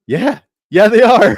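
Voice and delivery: loud, laughing yeah they are; laughing agreement. Retold or imitated. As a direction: agree through a big laugh. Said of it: "And I was like".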